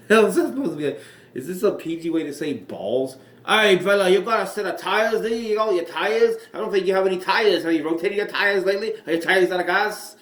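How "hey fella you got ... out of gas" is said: high, loud, hammed-up Irish accent; terrible Irish accent. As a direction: ham up a bad Irish brogue, calling out a rival. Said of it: "That was a terrible Irish accent".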